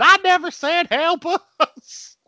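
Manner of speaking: high, drawn-out mock yell; high, slow mock scream. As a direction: squeal it out slowly, high-pitched. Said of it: "Pete's just screaming".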